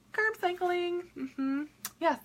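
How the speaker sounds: high, soft little voice; playful teasing answer. Pretend. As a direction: answer yourself high and softly. Playfully answers her own question.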